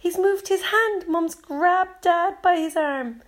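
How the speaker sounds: high, excited cry of discovery. A narrator's reading. Child tells of the patient moving his hand, excited. Family sees patient wake up.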